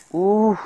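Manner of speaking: loud relieved huff. Real, not acted. Strain after the climb.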